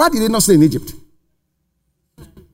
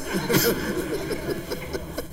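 breathy laugh; 14 dB quieter than usual. Real, not acted. Laugh after mocking.

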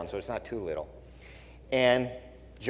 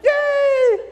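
very high, loud mock yay; mock cheer; 8 dB louder than usual. Pastor mocks rioting fans' cheer, very high squeal.

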